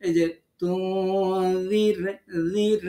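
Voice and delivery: slow chanted তুনজির with a light, unrounded "r"; thin ra. Shown as a demonstration. Teacher isolating the syllable for learners, slow thin "r" in তুনজির. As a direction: draw out তুনজির, lips natural on the final "r". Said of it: "ঠোঁটটাকে স্বাভাবিক রাখবেন".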